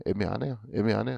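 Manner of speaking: quiet low musing; muttered to self. Real, not acted. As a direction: mutter it to yourself, puzzled.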